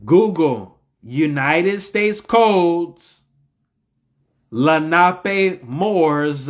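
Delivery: very slow, spaced-out words; slow dictation. Real, not acted. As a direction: dictate it word by word, drawn out. Repeating the search phrase for listeners.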